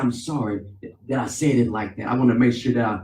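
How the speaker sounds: a hint of a humble apology; retold apology. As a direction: say sorry gently to a brother.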